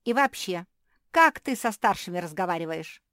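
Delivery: loud, indignant scolding; combative. A narrator's reading. Older sister reprimanding the younger, combative. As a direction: scold like an offended elder. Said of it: "кинулась в бой Капитолина".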